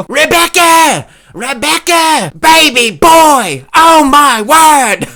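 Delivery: high, loud British commentator yell; Michael Bisping impression. Comic imitating fight commentator, high drawn-out yelling impression. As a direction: bellow it slowly, stretching every word. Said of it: "I have to yell it".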